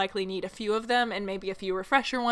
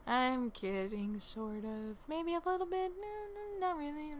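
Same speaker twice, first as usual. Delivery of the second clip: teasing; 7 semitones higher, 8 dB quieter than usual. Real, not acted. Teasing backpedal after a joke.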